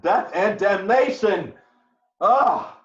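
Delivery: high, loud cry of rage; furious outburst. Acted. Husband bursts out at his ensign in a loud, furious cry. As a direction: hurl the curse out at full force.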